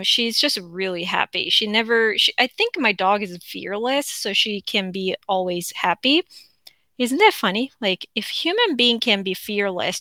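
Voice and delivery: ordinary delivery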